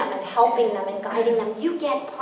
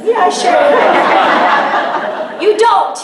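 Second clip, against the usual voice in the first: laughing loud; 7 semitones higher, 8 dB louder, much slower than usual. Real, not acted. Speaker laughing at a joke to the audience. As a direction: laugh through the sarcastic yeah, sure.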